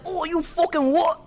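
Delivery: British yob voice through a laugh; mock British accent. Co-host imitating British colonizers, laughing mock British accent. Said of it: "so they were like".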